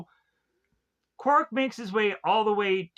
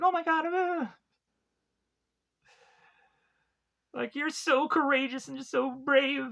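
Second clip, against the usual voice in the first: much higher, airy gushing voice; gushing wife; 10 semitones higher than usual. Retold or imitated. Wife swooning over her shrunken husband, high gushing voice. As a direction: coo it like an impressed wife.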